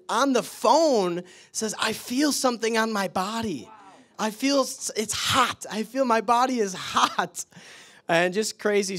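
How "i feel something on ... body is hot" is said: excited mother. Retold or imitated. Mother on phone feeling healing.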